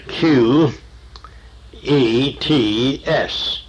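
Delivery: Q E T S letter by letter, very slowly; slow, deliberate spelling of Q E T S. Shown as a demonstration. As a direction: spell Q, E, T, S with each letter drawn out. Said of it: "that is the Hebrew word".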